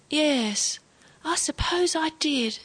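high, weary resignation; worn out. A narrator's reading. Confused grandfather accepts it was a dream, worn out. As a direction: give in, a touch exhausted. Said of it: "He sounded completely worn out".

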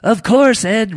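high, loud tiny-creature voice; talking shrimp. A narrator's reading. Shrimp assures Ed it hears thoughts, high shrimp voice. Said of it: "replied one of the shrimp".

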